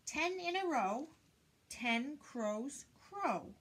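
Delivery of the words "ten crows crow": normal talking voice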